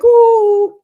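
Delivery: loud, high drawn-out "ooh"; mock ooh. Woman scoffs at expecting her nephews to comfort her, mock high ooh.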